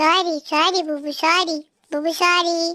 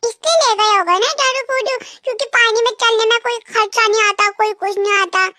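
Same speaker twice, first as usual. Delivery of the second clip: angry rant; 5 semitones higher than usual. Acted. Wife fuming that her husband only showed her water.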